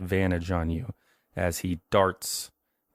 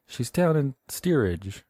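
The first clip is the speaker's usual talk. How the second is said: put-on nervous boy; 7 semitones higher, slower than usual. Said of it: "and he's like".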